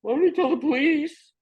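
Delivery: put-on very high kid voice threatening; little brother threatens police, high and loud; whiny threat. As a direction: squeak it out like a tattling little kid. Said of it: "brother threatens".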